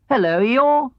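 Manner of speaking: put-on a cheerful boy greeting a friend. Christopher Robin greets Eeyore at the door, boyish.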